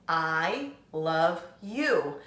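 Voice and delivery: slow, word-by-word counting; slow counting of the words for children. Shown as a demonstration. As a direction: space out each word to count them. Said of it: "How many words is that?".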